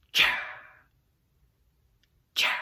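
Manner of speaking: breathy, voiceless "cha" with a long airy tail; beatboxed exhale. Shown as a demonstration. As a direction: hit the ch and let the breath rush out. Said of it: "supposed to kinda sound like an exhale".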